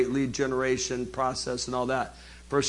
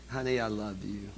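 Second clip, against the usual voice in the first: softer and lower, an intimate Honey, I love you; tender loving; 6 semitones lower, 9 dB quieter than usual. Shown as a demonstration. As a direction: murmur it lovingly to your spouse. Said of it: "See the difference in the tone of voice".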